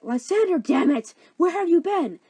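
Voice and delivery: high, loud demand; angry confrontation. Acted. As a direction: yell at a runaway boyfriend, hammed up. Hermia confronts missing Lysander.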